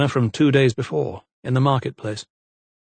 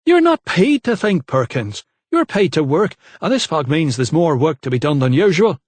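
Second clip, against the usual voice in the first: much higher, louder rebuke; angry steward scolding; stern scolding; 5 semitones higher, 5 dB louder than usual. A narrator's reading. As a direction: put the foreman firmly in his place.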